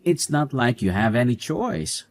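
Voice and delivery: normal talking voice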